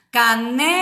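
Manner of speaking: "caneca" with NE stretched long; stress on NE. Shown as a demonstration. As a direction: hold the middle syllable of "caneca". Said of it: "Na penúltima, né".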